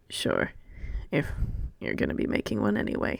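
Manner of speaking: Chifley accepts tea with a shy smile; shy whisper. Acted. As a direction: barely breathe it out with a smile. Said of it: "another of those smiles".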